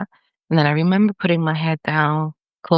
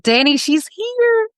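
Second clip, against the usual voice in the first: much higher, slow, urgent announcement; excited cry; slower than usual. Retold or imitated. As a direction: call out in high-pitched disbelief that she is found. Finding the missing woman, calling out.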